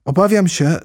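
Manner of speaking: plaintive. A narrator's reading. Alice regrets offending the mouse, plaintive. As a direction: lament your own blunder. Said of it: "zawołała Alicja żałośnie".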